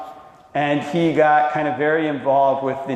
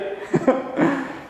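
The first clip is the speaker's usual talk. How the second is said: laugh; 10 semitones higher, 4 dB quieter than usual. Real, not acted. Laughs before an extreme quote.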